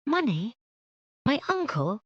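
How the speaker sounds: high, astonished questions; startled cry. Acted. Jane shocked at the uncle's money, startled. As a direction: jolt up in surprise. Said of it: "cried Jane".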